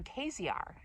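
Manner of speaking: "K Z R" as kay-zee-ar; Latin-like "K Z R" for Caesar; son's mispronunciation. Retold or imitated. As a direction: say "K Z R" like three letters run together. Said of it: "you can pronounce it that".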